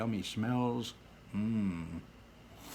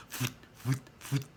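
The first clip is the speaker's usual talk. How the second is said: machine noise; 3 semitones higher than usual. Acted. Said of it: "every time it went".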